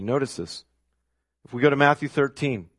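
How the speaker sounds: ordinary delivery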